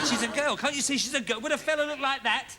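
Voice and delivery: normal talking voice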